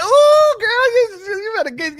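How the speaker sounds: high, loud squeal of delight; hammed-up high-pitched whoop; excited mock swoon. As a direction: react as if her accent just floored you. Acting out his smitten reaction to her.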